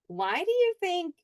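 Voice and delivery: usual speaking voice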